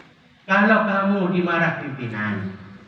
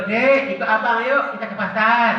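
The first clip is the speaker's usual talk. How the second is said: lightly voiced big brother, higher, friendly invitation; older brother voice; 8 semitones higher, 3 dB louder than usual. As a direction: coax the little brother to come along. Older brother calls Yusuf along. (abang mengajak adiknya ke pasar, suara dibuat-buat)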